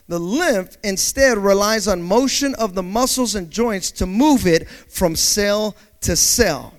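normal talking voice

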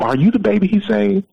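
retold astonishment, very high pitch; high, incredulous question; amazed realisation. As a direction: imitate a woman realising who is calling. Retold wife's climax of realisation.

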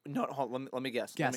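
whispering. Real, not acted. Whispered guessing.

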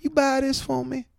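high, slow imitation of the woman shopper; drawn-out high voice asking a stranger; imitating woman customer. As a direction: put on her accented, high-pitched plea. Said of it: "she looks up and she goes".

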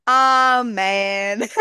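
drawn-out mock-Southern amen; hammed-up Southern church sign-off; Southern grace. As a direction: close the joke prayer with a Southern flourish. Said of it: "like, Southern, like, giving thanks".

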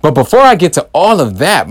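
normal talking voice

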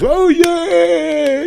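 high loud squealed cheer; mock crowd cheer. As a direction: cheer like a dumb studio crowd. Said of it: "the crowd was".

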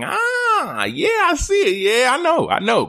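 high-voiced chorus of sudden recognition; knowing listeners' reaction. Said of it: "For the baseball watchers, you're like".

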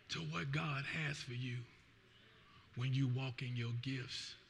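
slow, soft, low earnest preaching; intimate preaching. Real, not acted. Pastor urging church to use gifts, soft intimate preaching. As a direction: lean in and speak softly to each heart.

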